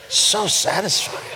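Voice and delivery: soft, slow, lightly imitated earnest grandson; serious five-year-old. Grandpa echoes his grandson's verdict, mock serious and slow. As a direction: savor it like a serious little boy. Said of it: "And he was serious".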